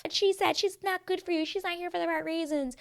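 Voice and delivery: high-pitched mock tattletale; put-on high gossip voice; tattling contestant. Voicing another contestant's tattling.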